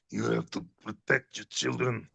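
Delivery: put-on quoting his film role in a light Russian accent; lightly voiced Russian accent, urgent warning. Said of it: "You should be able to do the Russian accent".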